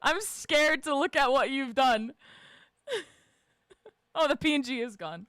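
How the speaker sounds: ordinary talk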